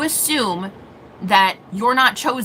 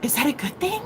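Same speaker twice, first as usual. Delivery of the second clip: soft puzzled mom; 4 semitones higher, 8 dB quieter than usual. Retold or imitated. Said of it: "she's like".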